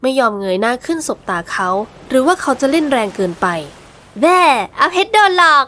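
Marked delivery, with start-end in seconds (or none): 3.8-5.6 put-on high, slow, cheeky girl's jeer; teasing taunt. Girl mocking the man she fooled, cheeky high taunt. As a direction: stick your tongue out with the words. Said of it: "แลบลิ้นปลิ้นตา".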